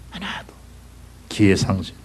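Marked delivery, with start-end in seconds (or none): 0.1-0.5 hushed high whisper of 하나도. Acted. Preacher echoing not one shall taste, whispered. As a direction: whisper it as a grim echo.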